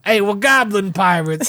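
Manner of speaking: lightly voiced goblin pirate; a hint of a goblin pirate. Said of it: "don't rip off my goblin voice".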